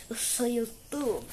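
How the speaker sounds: slow unvoiced whisper, secretive. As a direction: breathe it out slowly like a secret. Whispered boast to himself. (sussurro lento)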